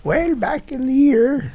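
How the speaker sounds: put-on slow, high creaky old-timer voice; old man voice. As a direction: drawl it like a rambling grandpa. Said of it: "fireside chat with an old person".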